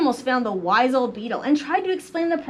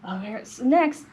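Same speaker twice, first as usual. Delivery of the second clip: plain reading voice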